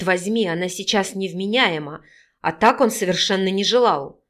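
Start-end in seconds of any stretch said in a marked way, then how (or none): none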